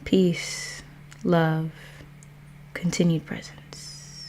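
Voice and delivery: soft, measured meditative delivery; mindful opening. Acted. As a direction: settle listeners gently into the present, phrase by phrase. Opens livestream with slow mindful intentions.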